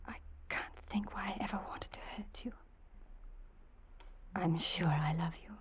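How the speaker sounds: faint whispered confession. Acted. Wife whispering her love to her husband.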